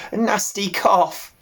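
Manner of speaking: put-on slow, high bleating old sheep; old ewe voice. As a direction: drawl it like a tired old ewe.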